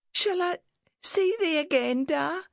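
put-on very high, pleading little girl; trembling child. A narrator's reading. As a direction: ask Da in a tiny anxious voice. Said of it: "Her lip trembled as she spoke".

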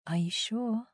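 soft, coaxing coo. A narrator's reading. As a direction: coo it gently, tempting her. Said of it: "she cooed".

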